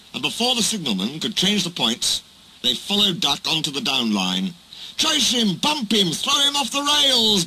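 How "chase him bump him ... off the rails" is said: high, rowdy yelled chant; yelling. A narrator's reading. Trucks urging each other after Duck, high yelling. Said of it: "they yelled".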